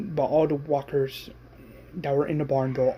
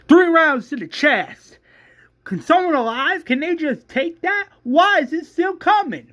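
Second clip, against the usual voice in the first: very high, strained yelling; acted scream; 12 semitones higher, 6 dB louder than usual. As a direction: yell your demand for an answer. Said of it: "like, screaming".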